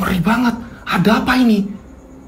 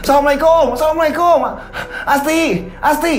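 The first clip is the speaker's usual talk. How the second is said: frantic calling; 8 semitones higher than usual. Acted. Riko bangs on the door, frantically calling his wife Asti. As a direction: call out urgently at the door.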